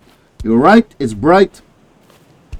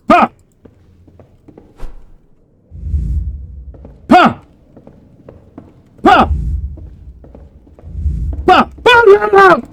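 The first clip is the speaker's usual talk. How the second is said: battle cry. Acted. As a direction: bark each hit out. Attack shouts while fighting.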